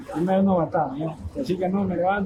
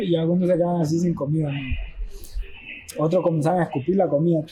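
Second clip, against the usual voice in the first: ordinary talk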